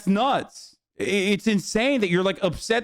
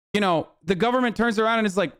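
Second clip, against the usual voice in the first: normal talking voice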